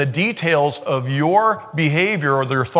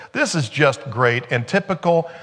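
ordinary delivery

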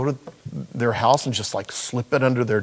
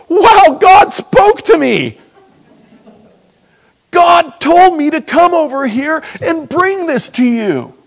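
high, loud imitation of crying out; tearful awe; 9 dB louder, slower than usual. Preacher acting the donor breaking down, tearful awe. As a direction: wail it in overwhelmed wonder, re-enacted. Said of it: "he broke down in tears".

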